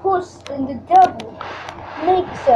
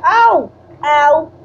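ordinary talk, nothing marked

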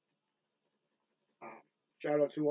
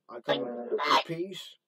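louder, firm call to unseen spirits; calling into the dark; 6 dB louder than usual. Real, not acted. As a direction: announce yourself to the spirits. Addressing spirits on ghost hunt.

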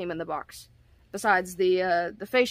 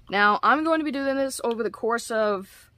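normal talking voice

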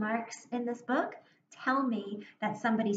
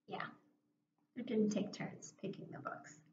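whisper; 11 dB quieter than usual. Acted. Teacher answers her own question.

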